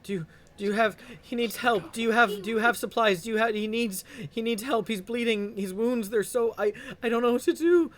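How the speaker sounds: high, loud panicked begging; desperate pleading. Acted. Wounded man's wife begs for help.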